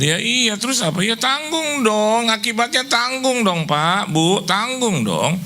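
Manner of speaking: high, drawn-out preacher scolding; preacher playing the fed-up lender; mock exasperated scolding. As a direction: hammer home "tanggung dong" up high. Preacher mocking borrower, then scolding.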